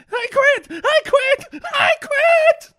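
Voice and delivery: high shrill screaming quit; Mankind yelling. Retold or imitated. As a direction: scream it like a crazed wrestler. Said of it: "with a tape of Mankind going".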